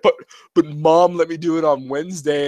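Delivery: put-on high, pleading kid voice; whiny son. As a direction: whine like a kid begging dad. Son whining to dad.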